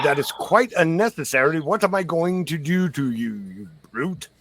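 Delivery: put-on high-pitched, protesting old captive; indignant old man. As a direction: play an affronted old man objecting to rough handling.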